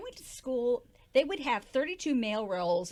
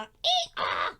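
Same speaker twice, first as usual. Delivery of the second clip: donkey bray; 4 dB louder than usual. Acted. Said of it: "acting like a donkey".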